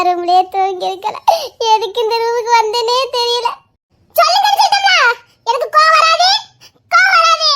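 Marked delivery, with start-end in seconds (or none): 4.2-7.5 higher, fast giggling plea; giggly pleading. Real, not acted. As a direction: coax him to tell, laughing. Wife repeats plea, laughing. (சிரித்தபடி உயர்ந்த குரலில் கெஞ்சல்)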